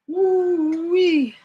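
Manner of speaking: high, excited woo-wee; excited whoop. Real, not acted. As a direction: whoop with delight at the plants. Admiring weed plants.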